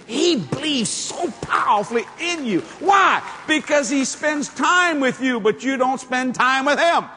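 high, slow, emphatic preaching. Acted. Preacher urging the congregation, full-force preaching. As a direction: drive the point home from the pulpit.

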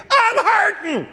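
sudden high mock scream of pain; retold wish to scream. Preacher retelling his morning prayer in pain, acted scream. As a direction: cry out high as if hurting. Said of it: "I was wanting to scream".

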